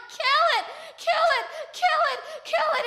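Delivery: high, loud repeated shout; yelling. Acted. Onlooker at the climax of the killing, frantic yelling. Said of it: "I'm yelling".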